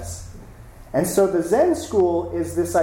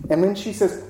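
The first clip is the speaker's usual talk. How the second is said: ordinary talk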